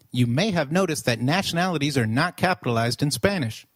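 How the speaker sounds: ordinary talk, nothing marked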